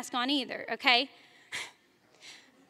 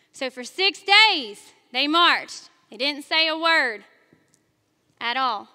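normal talking voice

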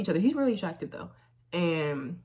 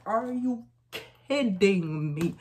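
higher, louder, drawn-out disbelief; exasperated outcry; 4 semitones higher, 3 dB louder, much slower than usual. Retold or imitated. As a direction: groan it out in disbelief. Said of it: "I was like".

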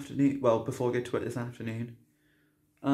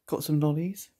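usual speaking voice

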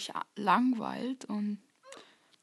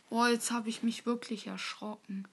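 ordinary talk, nothing marked

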